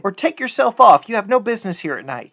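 loud, high threat; crying out. A narrator's reading. Boy ordering the figure away, loud and high. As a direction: shout him off the stairs. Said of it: "cried the boy".

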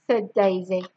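ordinary delivery

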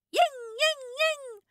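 high yelping whine; whimpering. A narrator's reading. Scratched dog whimpering after the cat's paw. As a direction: whimper like a hurt puppy. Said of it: "huilt Takkie".

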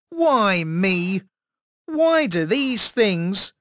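high, drawn-out lament; wailing complaint. A narrator's reading. Bitten boy laments his luck.

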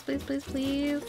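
ordinary talk, nothing marked

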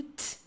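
crisp, aspirated "T"; strong T. Shown as a demonstration. Teacher showing the British consonant, strong "T". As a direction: release the "T" hard. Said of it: "som de T bem forte".

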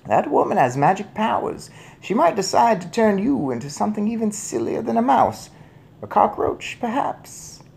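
put-on high-pitched old lady, softening at the end; teasing grandmother. A narrator's reading. Grandmother warns father of witch's powers, mischievous. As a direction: warn him with mischief.

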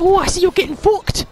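ordinary talk

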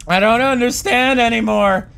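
usual speaking voice

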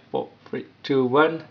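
ordinary talk, nothing marked

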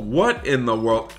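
ordinary talk

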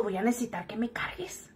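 hushed, almost no voice; retold whisper asking a coworker to carry her; whispered plea. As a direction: whisper the request to the friend.

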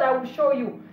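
ordinary talk, nothing marked

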